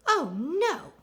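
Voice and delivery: high, loud, swooping gasp of dismay. A narrator's reading. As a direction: act out a shocked, dismayed cry. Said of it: "gasped Missus MacOtter".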